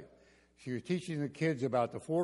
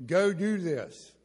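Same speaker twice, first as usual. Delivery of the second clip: teacher command; 4 semitones higher, 6 dB louder, slower than usual. Retold or imitated. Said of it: "And she would say".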